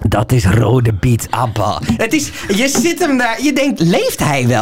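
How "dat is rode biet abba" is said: low, slow official announcer; Big Brother voice. Retold or imitated. Big Brother explaining beetroot to housemate, low announcer voice. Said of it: "die Big Brother zo".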